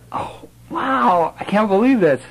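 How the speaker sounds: high squeaky mock wonder; mock amazement. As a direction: gush it high like a stunned kid. Retold own amazed reaction.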